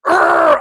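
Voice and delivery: loud, high growling roar. Retold or imitated. First grader standing up to teasing classmates, growl. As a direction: let out a sudden Hulk-style growl. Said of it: "I growled at everybody".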